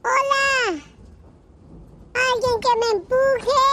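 high mock yell for help; calling out for help. Stranded man yelling for someone to push his car. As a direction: call out through the storm for a push. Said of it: "el hombre no dejaba de gritar".

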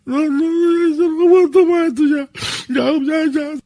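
high, broken mock grief; a pretend mourner refusing pills; faked despair. Prank caller fakes grief on phone. (desesperación fingida y quebrada)

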